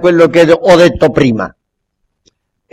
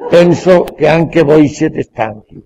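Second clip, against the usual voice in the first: usual speaking voice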